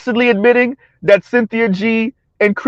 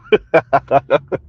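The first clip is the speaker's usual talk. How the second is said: low chuckling laugh; real laugh; 6 semitones lower than usual. Real, not acted. Host amused by a chat comment, chuckling.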